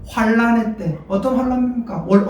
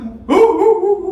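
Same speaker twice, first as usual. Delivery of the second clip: imitated sobbing; 8 semitones higher, 4 dB louder, much slower than usual.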